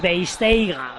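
"Beisteiga" drawn out slowly with overdone diphthongs; exaggerated pronunciation. Shown as a demonstration. Pundit mocks over-correct foreign names, exaggerated pronunciation. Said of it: "una pronunciación exagerada".

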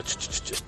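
soft unvoiced air-brake hiss. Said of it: "Pero él seguía".

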